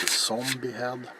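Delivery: ordinary talk, nothing marked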